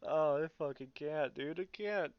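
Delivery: fighting back real laughter; words breaking apart into helpless laughs; cracking up. Real, not acted. Podcaster losing it over Luke flying Leia, laughing. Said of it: "I'm trying so hard not to laugh".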